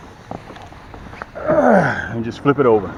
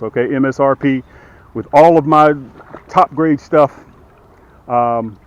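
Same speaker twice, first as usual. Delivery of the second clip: sales pitch; 2 semitones higher, 8 dB louder than usual. Acted. Sales pitch for his boats.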